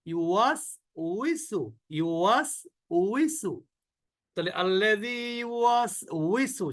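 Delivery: ইউওয়াসউইসু drawn out with a clear উই; slow, careful Quranic reading; slow model recitation. Shown as a demonstration. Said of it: "সবাই বলি".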